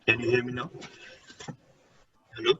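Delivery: ordinary talk, nothing marked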